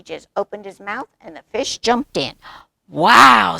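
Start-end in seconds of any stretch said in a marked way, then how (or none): 2.9-3.6 loud, wide-eyed wow; amazed little cub. A narrator's reading. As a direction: burst out in admiration. Cub admires grandpa's clever catch.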